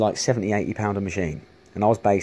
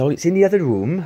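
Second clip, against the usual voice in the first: put-on high, loud amateur Welsh accent; a weak go at a Welsh woman's accent; 9 semitones higher, 5 dB louder than usual. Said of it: "I can't do a very good Welsh accent".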